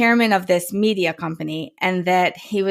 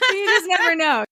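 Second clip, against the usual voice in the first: high and laughing, nearly tearful; 10 semitones higher than usual. Real, not acted. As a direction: let the laugh carry the words. Guest still laughing.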